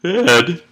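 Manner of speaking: a loud, high yawn escaping over a name; yawning through the student's name; real yawn. Real, not acted. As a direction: let a big yawn out mid-sentence. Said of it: "pardon me while I yawn".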